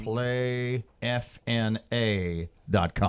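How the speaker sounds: slow, spaced-out dictation; slow spelling-out. Real, not acted. Host repeats the website for listeners, spelling it slowly. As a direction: spell the web address out slowly.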